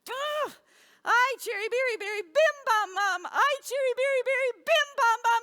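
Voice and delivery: high, loud hammed-up wailing; dizzy confusion. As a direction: spin out into flustered exclamations. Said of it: "she gets so confused and she's dizzy".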